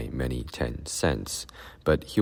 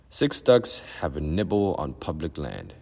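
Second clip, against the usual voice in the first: ordinary delivery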